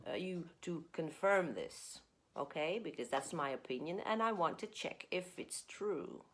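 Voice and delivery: ordinary talk, nothing marked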